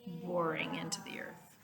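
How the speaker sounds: soft unvoiced whisper; hushed explanation. Shown as a demonstration. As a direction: breathe it as an aside. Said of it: "This kind of boring means".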